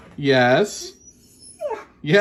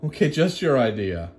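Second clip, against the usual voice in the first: voicing the dog; 9 semitones lower than usual.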